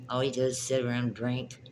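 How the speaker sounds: usual speaking voice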